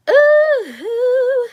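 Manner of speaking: loud, very high, drawn-out ooh. Real, not acted. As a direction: swoop down and back up on a high ooh. Exclaims between remarks to viewers.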